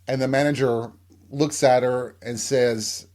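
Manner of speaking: ordinary delivery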